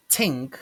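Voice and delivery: "tink" with t in place of th; "tink" opening on a hard t; mispronunciation. Shown as a demonstration. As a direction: say "tink" for think. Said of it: "It's not tink".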